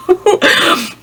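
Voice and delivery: short high giggle; high nervous laugh. Real, not acted. Laughing at her own nerves.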